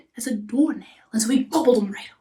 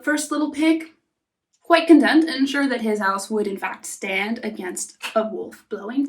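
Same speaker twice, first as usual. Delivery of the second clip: ordinary delivery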